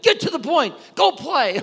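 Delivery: high, loud exasperated brush-off; impatient parent. Pretend. Parent tired of a pestering kid, exasperated. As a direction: shoo the kid away.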